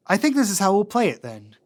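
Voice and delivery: ordinary delivery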